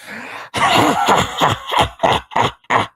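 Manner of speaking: breathy sinister laugh; a dark creature's wheezing cackle; evil laugh. Acted. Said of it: "who in return goes".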